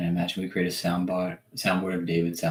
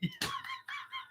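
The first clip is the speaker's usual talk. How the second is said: high wheezing laugh; real high-pitched giggle fit; 12 dB quieter than usual. Real, not acted. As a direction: crack up helplessly. Cracks up imagining the voice.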